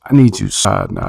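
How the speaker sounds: normal talking voice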